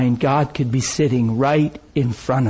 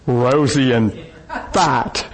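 normal talking voice

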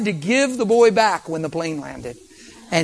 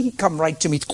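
normal talking voice

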